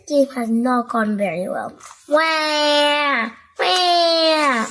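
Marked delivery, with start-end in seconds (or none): 2.1-4.7 loud high mock crying; toddler wails. Two little brothers wailing over squished sandcastles. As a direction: wail like upset toddlers. Both boys cry.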